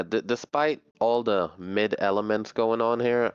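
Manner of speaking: normal talking voice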